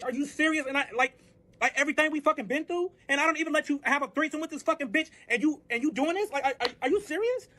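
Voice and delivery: high-pitched indignant tirade; worked-up retelling of a furious outburst; outraged rant. Retold or imitated. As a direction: vent in disbelief, pitch climbing as you go. Acted outrage at being left.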